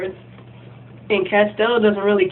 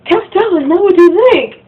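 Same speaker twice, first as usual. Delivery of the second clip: high, loud, caught-out panic; panicked plea; 10 semitones higher, 9 dB louder than usual. Retold or imitated. Girlfriend caught with another boy, high loud panicked plea. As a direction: beg him not to get the wrong idea. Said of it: "And she's like".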